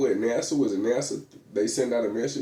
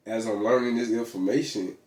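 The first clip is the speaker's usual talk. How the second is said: normal talking voice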